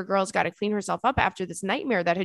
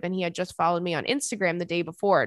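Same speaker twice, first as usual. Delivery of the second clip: ordinary delivery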